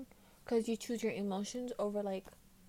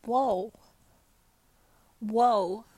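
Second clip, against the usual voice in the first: mock amazement, growing louder with each whoa; awestruck at self; 10 dB louder, much slower than usual. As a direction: marvel at your own reflection, building to loud. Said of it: "I look in the mirror, I'm like".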